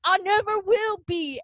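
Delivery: mock dramatic vow. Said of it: "And he's like".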